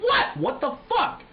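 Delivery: squeaky high shocked outburst; startled what. Retold or imitated. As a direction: jump up in confused alarm. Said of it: "I'm like".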